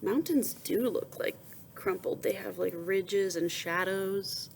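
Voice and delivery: plain reading voice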